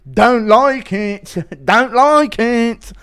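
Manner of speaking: high, loud, slow stubborn grumble; Menifield impression. Man refusing police orders, slow and loud. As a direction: drag it out, refusing to budge. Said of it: "Impression of Alonso Menifield".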